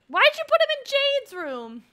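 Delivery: high, loud and incredulous; shocked outcry. Real, not acted. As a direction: blurt it out in disbelief. Sim put baby in wrong room.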